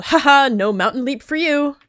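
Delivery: loud, high taunting singsong; gloating tease. Acted. Sister gloating loudly over her leader sister. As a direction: rub it in her face.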